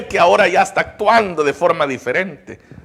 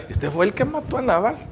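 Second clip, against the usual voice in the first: softer, quicker, higher gossip; gossiping aside; 4 semitones higher, 9 dB quieter, faster than usual. Retold or imitated. People gossiping once the king turns, hushed aside. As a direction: murmur it behind the king's back, quickly. Imagined gossip behind the king's back.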